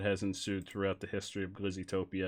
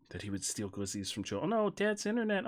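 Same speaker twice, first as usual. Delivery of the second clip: usual speaking voice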